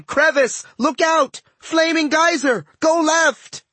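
high, urgent warning shouts; panicked yelling. A narrator's reading. Terrified passenger warns the reckless driver, panicked high yelling. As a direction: yell out each hazard in high-pitched alarm. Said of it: "I was too busy yelling".

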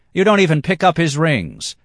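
high, loud reproach; scolding. A narrator's reading.

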